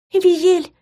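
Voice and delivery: put-on high, faint girl's call; weak call. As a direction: call out weakly like a tired girl. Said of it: "слабо позвала Рине".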